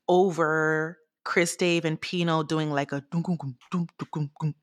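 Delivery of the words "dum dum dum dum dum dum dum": voiced bass-and-drum pattern; vocalized groove. Shown as a demonstration. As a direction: tap out a beat with dull syllables. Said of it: "like, groove".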